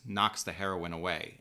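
ordinary delivery

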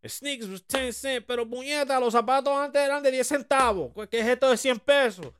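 high-pitched indignant grandfather, switching into Spanish; grandfather grumbling. Retold or imitated. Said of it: "My grandfather was like".

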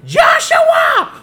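high, loud mock shout with a laugh; mock mom yell. Mom shouting at her son, high hammed-up yell. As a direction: yell the name up the stairs like an angry mom. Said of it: "She's like".

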